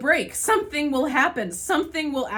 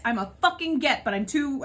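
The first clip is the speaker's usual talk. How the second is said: usual speaking voice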